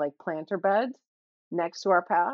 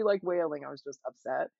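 ordinary talk, nothing marked

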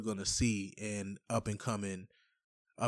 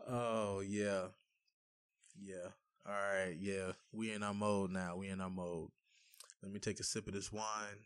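stoned; 2 semitones lower, 5 dB quieter than usual. Real, not acted. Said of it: "I'm high as hell".

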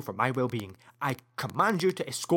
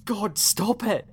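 anguished shout; 9 semitones higher, 7 dB louder than usual. Acted. Seth crying out against his memories, anguished shout. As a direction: break down at the top of your voice.

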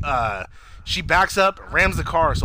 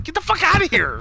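high-pitched retold outburst; snapping; 4 dB louder than usual. Imitating her telling the man to leave, high snapping. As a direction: snap it out as her angry retort. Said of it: "snapping on him".

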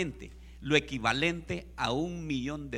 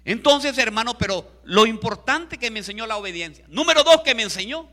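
high, loud preacher's declaration; emphatic preaching; 5 semitones higher, 12 dB louder than usual. Real, not acted. Preacher moving the congregation on to lesson number two, emphatic preaching. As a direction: announce the next point forcefully.

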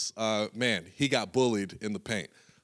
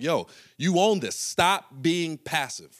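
higher, louder, slow coach yell; 6 semitones higher, 4 dB louder, slower than usual. Retold or imitated. Coach yelling at Chris to stop being passive. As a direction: bark it like a fired-up coach. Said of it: "Coach Bradley was yelling at Chris".

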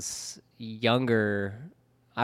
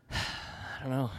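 sighing; 12 dB quieter, much slower than usual. Real, not acted. Exasperated at the bike theft.

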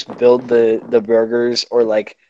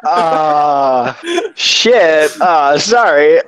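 slow, stretched hesitation; at a loss; 2 semitones higher, 3 dB louder, much slower than usual. Retold or imitated. Worker who heard nothing, slow helpless stalling. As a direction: drag out the uh, helplessly stuck. Worker lost by mumbled order.

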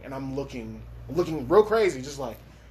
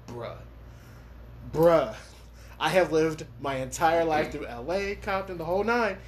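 retold disbelief; 6 semitones higher than usual. Inner panic retold.

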